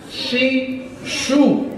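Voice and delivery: teacher demo of "西数"; drilled syllables. Shown as a demonstration.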